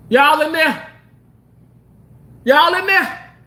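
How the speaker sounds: high, loud call into a closet; playful high searching call. Pretend. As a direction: shout to someone hiding. Calling into the closet.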